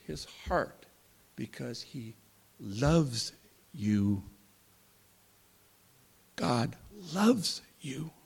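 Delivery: hushed, slow, gentle. Real, not acted. Preacher telling the congregation tenderly that God loves them. Tender reassurance of God's love.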